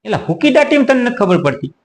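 can't believe he doesn't know; exasperated disbelief. Real, not acted. Exasperated at the friend who asks what હુકી દાટી means, incredulous.

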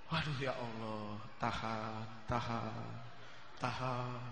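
low soft murmur to himself; quiet self-restraint. Retold or imitated. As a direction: hold yourself back under your breath. Menahan godaan, bergumam. (gumaman pelan menahan diri)

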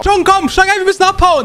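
high-pitched, panicky urging; urgent getaway shout. Acted. Babysitter to boy fleeing the bill, high urgent call. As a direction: rush him into the car, voice high.

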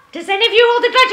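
normal talking voice

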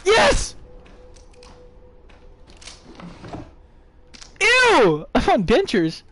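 high loud excited yelp; excited shout. Real, not acted. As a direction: yell with delight, then disgust. Reacting to finds in game.

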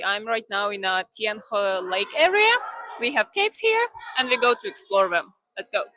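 loud voice echoing in a cave. Real, not acted. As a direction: speak up inside a big echoing cave. Vlogger speaking inside a cave.

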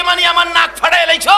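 high, fast, outraged comeback; indignant retort. Acted. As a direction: throw the question back in acted outrage. Wife angrily answers husband. (উঁচু গলায় দ্রুত ক্ষুব্ধ জবাব)

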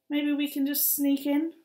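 usual speaking voice

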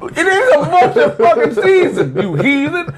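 high, laughing scolding; mock outrage. Host scolds co-host about Easter, mock outrage. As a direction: scold in exaggerated high-pitched indignation.

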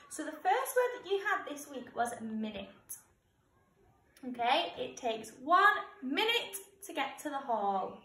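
clear, measured teacher dictation voice; slow school dictation. Acted. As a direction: read each word out carefully for the class. Teacher reads spelling test words.

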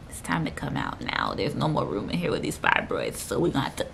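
very low, soft, deadpan voice; imagined baby's voice. Retold or imitated. Baby deciding to leave the womb, low soft deadpan.

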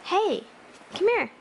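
high, loud call to a puppy; calling the dog. Real, not acted.

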